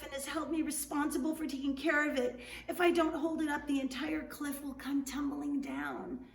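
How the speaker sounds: high, urgent, strained near-shout; urgent near-yell. Acted. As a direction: Juan straining under the cliff, half-yelling for help. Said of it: "almost yelling this time".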